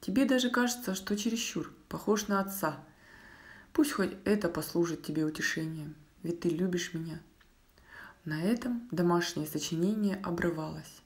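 plain reading voice

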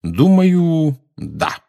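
mock-serious deliberation. A narrator's reading. Lupin answering his teasing friend, with studied seriousness. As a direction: draw out the pondering, then drop firmly. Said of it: "серьёзно ответил Люпин".

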